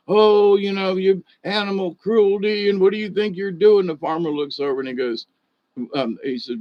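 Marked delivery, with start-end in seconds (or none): 0.1-3.9 higher, louder outraged city slicker yelling; retold holler. City slicker yells at the farmer, outraged and loud. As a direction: bellow it at him like an outraged bystander. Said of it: "he's hollering at the farmer".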